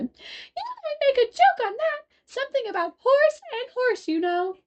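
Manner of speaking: very high, slow tiny gnat. A narrator's reading. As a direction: squeak it like a little insect. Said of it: "an extremely small voice".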